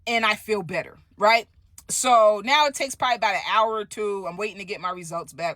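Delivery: normal talking voice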